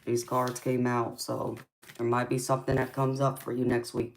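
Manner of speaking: usual speaking voice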